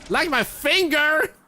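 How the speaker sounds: high, loud, slow yell; alarmed shout. Real, not acted. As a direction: shriek in sudden alarm. Yelps as game hurts finger.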